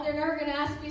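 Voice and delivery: ordinary talk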